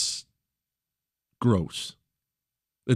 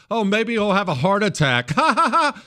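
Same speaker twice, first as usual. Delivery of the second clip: high, louder gleeful mockery with a laugh; mock cackling Democrat; 10 semitones higher, 4 dB louder than usual. Host mimicking people joking about Trump dying. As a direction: sneer like someone hoping for a death. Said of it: "I heard it all the time".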